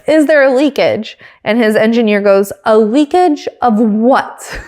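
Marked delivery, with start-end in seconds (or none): 2.6-4.6 slow, drawn-out imitation, each syllable separate; enunciating every syllable. Engineer answering his driver on the radio, enunciating every syllable. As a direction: spell it out syllable by syllable, very slowly. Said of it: "Literally enunciating every syllable".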